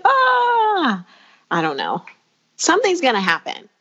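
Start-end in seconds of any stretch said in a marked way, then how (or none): 0.0-1.1 sudden high shocked "Ah!"; startled shriek. Retold or imitated. Someone finding it in the closet, a high startled "Ah!". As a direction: gasp out a high startled cry. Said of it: "they'll be like".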